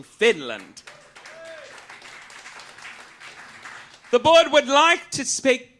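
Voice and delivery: loud, mock-official announcement; booming mock-formal declaration; loud board proclamation. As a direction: bellow it like an official decree. Drunken proclamations to the crowd.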